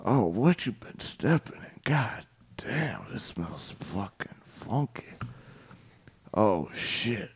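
low breathy creep whisper; whispered cat voice. Pretend. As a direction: hush it like a sneaky cat.

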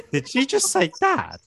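jaw-dropped, did she really say that; stunned disbelief. Retold or imitated. Reacting to friend's blunder.

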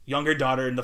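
ordinary talk, nothing marked

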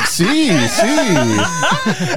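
amused reply. Retold or imitated. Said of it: "I was like".

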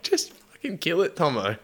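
exasperated order. Retold or imitated. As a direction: push it up high, fed up. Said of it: "I was like".

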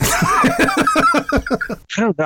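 laughing it out, drawn out. Real, not acted. Laughing after the co-host teases him about the pauses. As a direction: giggle through the words.

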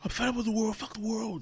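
high, loud mock rant at the world; mock angry outburst. Streamer mocks the rant he won't make, loud and high. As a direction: blow up like the angry guy he's not. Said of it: "this is not me saying".

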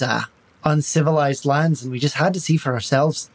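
light Irish lilt, charming and evasive; Irish accent. Acted. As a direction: talk the bandits round in a mild Irish brogue. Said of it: "Now you're making me Irish".

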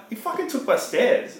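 high, slow, indignant mock complaint; outraged, slow; retold outrage. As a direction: replay your own outrage, drawn out. Retelling outrage over stolen stairs.

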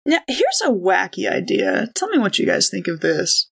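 ordinary talk, nothing marked

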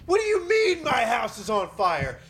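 high-pitched, incredulous outburst; mock outraged yell. Homeowner to firefighter about the burning house, indignant. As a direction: start high and stretched, then demand an explanation.

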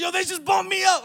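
loud, high brother yelling news; excited shout. Retold or imitated. Brother on the phone shouting that he got bumped up. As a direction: yell it down the phone, thrilled.

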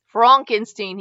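"Frankenstein" said as FRONK-en-steen; corrected pronunciation. A narrator's reading. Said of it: "he corrected".

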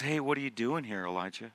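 very soft, low question; gentle near-whisper. Acted. God gently asking Elijah why he is here, hushed. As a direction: ask it kindly under the voice. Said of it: "Whispering encouragement".

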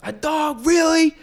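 high, rising disbelief; incredulous dad. Retold or imitated. Dad questioning the new dog, incredulous imitation. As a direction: imitate a father scandalized by the news. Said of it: "That's a good impression".

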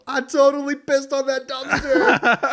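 high, loud, slow shout; drunk brag shout. Retold or imitated. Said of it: "So I go back in and I go".

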